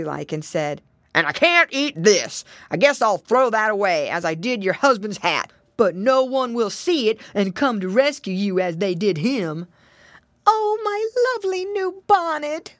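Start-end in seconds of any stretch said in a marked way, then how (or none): 1.1-9.7 loud, gruff storybook villain; rough wolf voice. A narrator's reading. Wolf taunts his captives over the bonnet, roughly. 10.5-12.8 high wail of dismay; pig lady's cry. A narrator's reading. Pig lady sees her bonnet snatched, crying out. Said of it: "cried Missus Twistytail".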